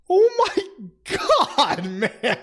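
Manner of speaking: very high, slow squeal of laughter; laughing disbelief. Real, not acted. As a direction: shriek through laughter at the insult. Laughing at Gandalf's fat insult.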